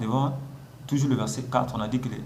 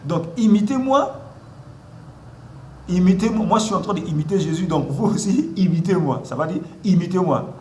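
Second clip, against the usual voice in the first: full-force preaching; 2 semitones higher, 5 dB louder than usual. Acted. Pastor urging the church to imitate him, loud preaching. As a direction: hammer the call home to the congregation.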